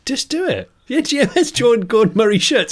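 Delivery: high, loud excited pitch; eager urging. Acted. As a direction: push the idea excitedly, voice way up. Playfully urging Gordon Murray on.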